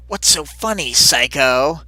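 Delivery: hammed-up irritated demand; acted angry challenge. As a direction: throw it out as an accusation. Acts Amy confronting Taylor.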